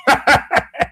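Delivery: quick run of ha-ha laughter; scoffing laugh. Real, not acted. Commentator on people who refused to listen, scoffing laugh.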